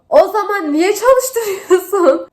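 outraged laugh through tears at the owner; tearful laughing outrage. Real, not acted. Angry at salon owner, near tears.

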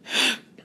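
a sharp unvoiced puff of air through the throat; breathy air push. Shown as a demonstration. Coach demos air push instead of coughing, unvoiced. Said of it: "what I just did".

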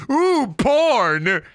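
high, excited cartoon baby squeal. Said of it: "I voiced a baby and the baby said".

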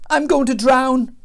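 high, loud wail; acted storybook misery; groaning dismay. A narrator's reading. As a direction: moan it out like a doomed man. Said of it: "Jonah groaned".

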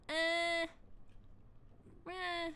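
high-pitched "eh" whine; whiny bleat. Real, not acted. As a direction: let out a high, nervous bleat. Nervous noises before "I'm scared.

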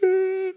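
a high startled gasp in; sharp gasp. Acted. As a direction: gasp like a shocked mother. Mother waking to find baby.

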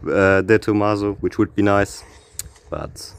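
ordinary talk, nothing marked

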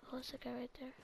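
real whisper, enemy close by. Real, not acted. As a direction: breathe it out so nobody hears. Spots enemy nearby, whispers.

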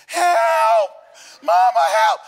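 high, loud cry for help. Retold or imitated. Little girl stuck, finally yelling for Mama, loud high cry. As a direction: yell for Mama with everything. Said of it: "when she cried out like that".

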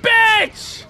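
high, loud cries of defeat; yelp, then scream each word separately; anguished gamer yell. Real, not acted. Player losing in Mario, high outraged screams. Player yelps and curses at game.

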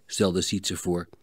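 plain reading voice

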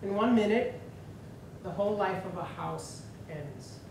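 measured, solemn poetry reading with pauses between phrases; poem reading. Acted. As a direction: read the poem out in deliberate phrases, each list item set apart. Reads a translated poem aloud to audience.

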